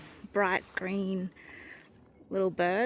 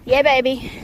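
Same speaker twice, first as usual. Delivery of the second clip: cheering; 2 semitones higher, 10 dB louder than usual. Real, not acted. Cheering heading downhill.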